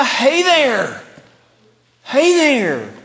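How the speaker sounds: high, loud, drawn-out hey there; exclaimed greeting. Shown as a demonstration. Jesus greeting his dear ones, passionate exclamation. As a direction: call out with great passion. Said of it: "emphasized with an exclamation point".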